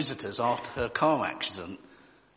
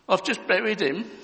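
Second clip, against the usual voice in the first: higher mock child voice; a hint of a sad little girl; tearful little girl; 8 semitones higher, 3 dB louder than usual. Jenny to the neighbour, mild tearful child voice. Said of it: "replied the girl tearfully".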